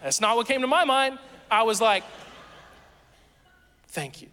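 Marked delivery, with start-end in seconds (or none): 3.9-4.3 soft, sheepish thank you through a laugh; awkward thanks. Retold or imitated. Him to the praying girls, soft sheepish thanks. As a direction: mumble it, caught out.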